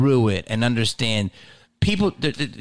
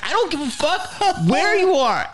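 high-pitched mock outburst; hammed-up fed-up yelp; retold exasperation. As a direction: blow up at an absent friend. Retells own exasperated outburst.

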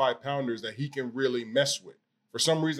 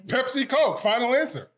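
excited; 11 semitones higher, 4 dB louder than usual. Real, not acted. Repeats answer louder, higher.

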